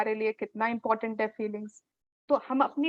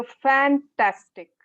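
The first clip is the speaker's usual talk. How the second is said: slow, louder "fantastic" with real delight; strong feeling; 3 semitones higher, 5 dB louder, slower than usual. Shown as a demonstration. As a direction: burst out with genuine enthusiasm. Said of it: "आपकी strong feeling आती है".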